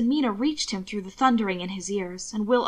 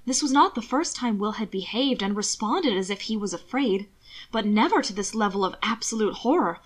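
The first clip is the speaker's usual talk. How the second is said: usual speaking voice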